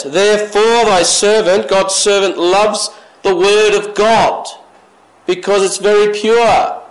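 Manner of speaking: fervent pulpit reading; preaching. Acted. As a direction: drive the verse home. Preaching scripture at force.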